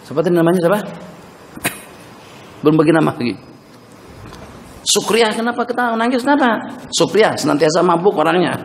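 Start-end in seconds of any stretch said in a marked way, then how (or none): none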